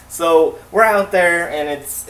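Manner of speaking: ordinary talk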